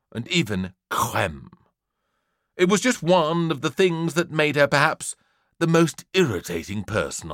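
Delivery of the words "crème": "crème" with an affected French r; mock French accent. A narrator's reading. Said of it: "The teacher did the same when pronouncing".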